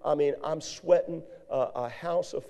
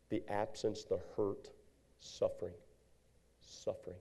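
grieving hush; 6 semitones lower, 12 dB quieter than usual. Real, not acted. Grief over his brother's death.

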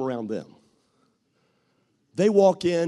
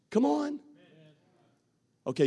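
ordinary talk, nothing marked

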